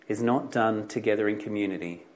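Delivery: ordinary talk, nothing marked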